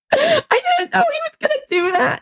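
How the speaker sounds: very high squeal, almost crying with laughter; helpless laughter. Real, not acted. Patient protesting the examiner's roast to a friend, laughing to tears. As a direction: wail it out while cracking up.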